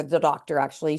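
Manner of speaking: ordinary delivery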